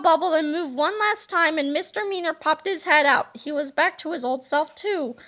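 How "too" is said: usual speaking voice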